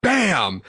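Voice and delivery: high, falling "bam" impact; imitated crash. Telling friends the cart hit the ground, exclaimed crash noise. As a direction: punch out a crash sound effect.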